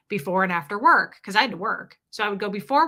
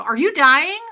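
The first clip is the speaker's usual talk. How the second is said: high, loud mock-alarmed question; teasing alarm; 7 semitones higher, 10 dB louder than usual. Woman always teasing her coughing partner, exaggerated mock alarm. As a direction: pitch the teasing question right up, loudly.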